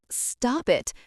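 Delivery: a high, breaking plea; choked-up stop it; voice cracking. A narrator's reading. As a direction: let the voice crack on the words. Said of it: "his voice cracked".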